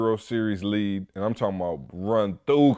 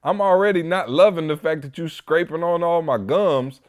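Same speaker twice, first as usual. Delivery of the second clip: exasperated; 6 semitones higher than usual. Retold or imitated. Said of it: "I'm like".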